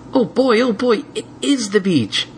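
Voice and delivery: high, thrilled little dragon; excited kid voice. Acted. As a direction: squeal with delight. Spike thrilled.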